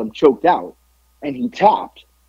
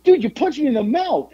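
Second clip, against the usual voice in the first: high-pitched self-justifying comeback; retold indignant retort; 12 semitones higher than usual. Wrestler to the man who punched him, indignant retort. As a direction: imitate an argument, voice pitched way up. Retold quarrel after the kick.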